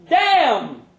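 a thrilled fan exclaiming; excited exclamation. Acted. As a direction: shout it out with delight. Woman in crowd exclaims.

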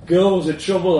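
light jokey quip; joking. A narrator's reading. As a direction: toss it off as a joke. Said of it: "I joked".

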